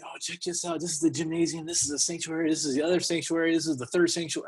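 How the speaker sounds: a touch of a bragging brother giving a tour; proud tour guide. Retold or imitated. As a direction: mimic a brother proudly showing off. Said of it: "my brother is showing it off to me".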